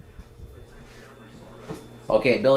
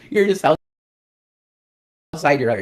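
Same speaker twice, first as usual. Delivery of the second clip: laughing through the words, higher; 5 semitones higher than usual. Real, not acted. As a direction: let a laugh break in. Laughing while talking.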